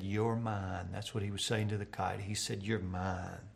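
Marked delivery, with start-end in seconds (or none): 0.0-0.9 soft, lowered voice to the kite; quiet, loving murmur; tender hush. A narrator's reading. Boy whispers to his kite. 2.6-3.5 even quieter and lower, full of awe; hushed, wondering murmur; softer tender repeat. A narrator's reading. Boy repeats to his kite.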